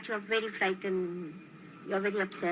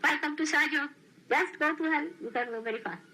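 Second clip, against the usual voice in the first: mock welcome; 6 semitones higher than usual. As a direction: jokingly wave them off. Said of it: "I would say like that".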